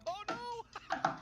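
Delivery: sudden high-pitched shocked cry; surprised shout. Real, not acted. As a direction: yelp out in real shock. Shocked at opponent's play.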